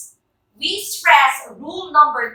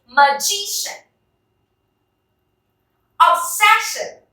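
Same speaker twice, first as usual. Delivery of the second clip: magician and Obsession, higher, stressed on the middle syllable; stress before final syllable; 6 semitones higher than usual. Shown as a demonstration. Teacher leads rubber-band drill, middle syllable stressed in magician and Obsession. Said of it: "try to use our rubber bands".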